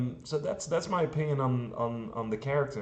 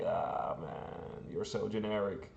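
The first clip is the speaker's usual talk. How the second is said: muttered mock scorn; 4 semitones lower, 9 dB quieter than usual. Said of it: "and it's like".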